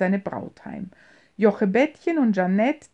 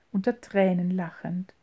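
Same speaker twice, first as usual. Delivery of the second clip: usual speaking voice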